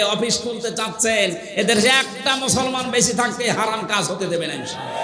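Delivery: high, insistent sermon voice; forceful preaching. Real, not acted. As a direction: drive each point home to the crowd. (জোরালো ওয়াজের উঁচু গলা)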